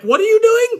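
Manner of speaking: high, loud bewildered referee voice; baffled referee. Retold or imitated. Referee asks Carmella mid-match, high and baffled. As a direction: squeak it out like a confused ref. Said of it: "Mike Chioda's like".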